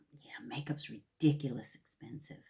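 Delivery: a conspiratorial whisper. Real, not acted. Vlogger whispering to viewers about makeup prices. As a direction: lean in and whisper it.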